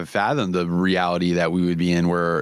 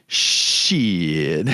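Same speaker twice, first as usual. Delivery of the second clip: SHIT stretched into one long, gliding vowel; drawn-out shit; 2 semitones lower than usual. Acted. Reading off a list of things not to say, shit hugely elongated. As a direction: draw out "shit" as if spelt with seven E's. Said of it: "with, like, seven E's".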